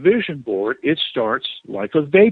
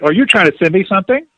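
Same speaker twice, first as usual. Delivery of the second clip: imitating her sharper, raised voice; a touch higher and louder, pointed; 6 semitones higher, 5 dB louder than usual. Scolding the struggling manifester, imitated raised voice. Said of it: "she raised her voice a little bit".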